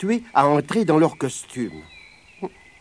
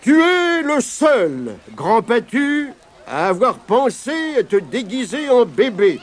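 higher, measured royal voice, slowing at the end; lion king; 5 semitones higher than usual. Acted. The lion king awards Grand Patu the prize, stately. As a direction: announce the winner with stately kindness. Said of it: "le roi lion prit la parole".

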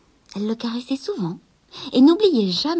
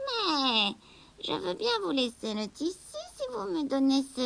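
witch; 5 semitones higher than usual. Acted. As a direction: tempt the girl to give the cat. La sorcière marchande. (sorcière rusée, voix plus aiguë)

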